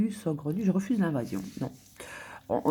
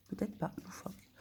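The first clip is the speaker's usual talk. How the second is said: whispered doubt; 13 dB quieter than usual. Real, not acted. Weighing a synonym, whispered to herself. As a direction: breathe it out, unsure.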